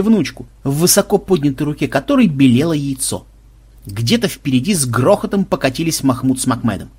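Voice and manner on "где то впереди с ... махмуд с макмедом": ordinary delivery, nothing marked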